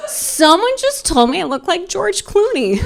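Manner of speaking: high, fast and giddy; bursting with his big news, laughing; a thrilled husband gushing at his wife; excited husband. Retold or imitated. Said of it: "And he's like".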